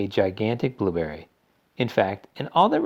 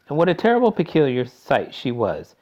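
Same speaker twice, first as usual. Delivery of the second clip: usual speaking voice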